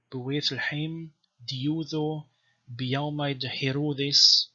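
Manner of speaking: slow, measured reading of Syriac Gospel text; chanted-style Syriac reading; scripture recitation. Shown as a demonstration. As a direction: recite the verse as sacred text. Reads Aramaic Gospel aloud.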